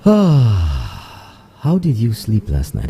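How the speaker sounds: sighed, very slow and stretched; slow drawn-out question. Acted. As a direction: stretch every word sleepily.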